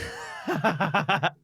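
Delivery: high villainous cackle; goblin cackle. Acted. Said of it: "That's that voice you do".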